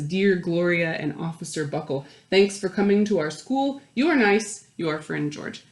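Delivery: a hint of a child's letter voice; reading a letter. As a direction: lightly read child's letter.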